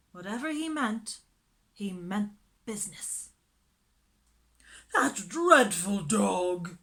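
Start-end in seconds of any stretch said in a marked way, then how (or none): 4.9-6.8 slow, loud, disgusted fussy lady; disdainful lady. A narrator's reading. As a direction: sneer at the dog slowly. Miss Lark disdainful.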